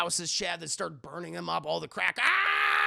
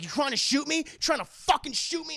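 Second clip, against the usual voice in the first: higher, louder furious challenge; mock angry retort; 8 semitones higher, 5 dB louder than usual. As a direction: snap back at the shooter. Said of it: "And he's like".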